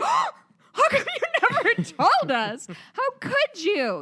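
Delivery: hammed-up gasping shock breaking into laughter; mock outraged men. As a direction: shriek it like a scandalised dude. Guys gasp at women's yearly plea.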